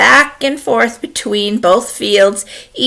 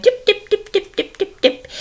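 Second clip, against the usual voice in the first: high tiny tips; light, high patter across the bridge; evenly tapped high little hoof sounds; little goat's footsteps; 14 semitones higher than usual. Acted. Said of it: "he started going".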